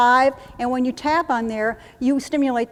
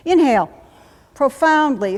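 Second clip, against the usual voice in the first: breathing command; 3 semitones higher, 7 dB louder than usual. Shown as a demonstration.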